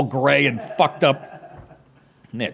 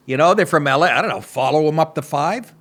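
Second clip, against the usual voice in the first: ordinary delivery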